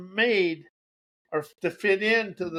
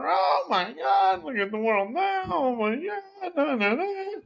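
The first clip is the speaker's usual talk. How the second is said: mock whining; 9 semitones higher than usual. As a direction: whine it in a hammed-up, swooping cry. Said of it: "whining and crying".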